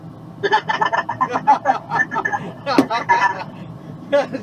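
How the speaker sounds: loud rising burst of laughter; hearty laugh. Real, not acted. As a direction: crack up at your own joke. Laughing right after crude boast.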